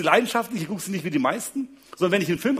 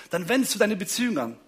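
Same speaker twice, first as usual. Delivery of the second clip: normal talking voice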